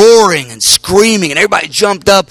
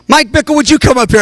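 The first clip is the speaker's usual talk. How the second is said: calling out; 8 semitones higher than usual. Retold or imitated. Benny calls him up.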